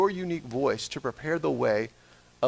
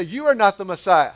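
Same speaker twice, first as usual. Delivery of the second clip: told to a neighbor; 4 semitones higher, 6 dB louder than usual. Shown as a demonstration. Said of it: "Turn to your neighbor and say".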